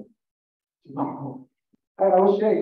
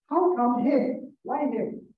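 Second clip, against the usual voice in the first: indignant crowd; 8 semitones higher, 4 dB louder, slower than usual. Acted. Grumbling crowd questions Jesus.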